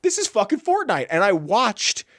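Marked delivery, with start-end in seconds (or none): 0.0-1.1 high excited exclamation; out loud. Retold or imitated. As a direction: burst out in amazed disbelief. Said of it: "out loud in the theater".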